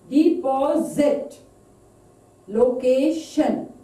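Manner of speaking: "suspicious" to "insurance" slowly broken up, second syllable stressed. Shown as a demonstration. Teacher giving stress examples, "suspicious" to "insurance" stressed on second syllable. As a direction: lean on the second beat in "suspicious, deposit, location, mechanic, adventure, insurance". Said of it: "is on the second syllable".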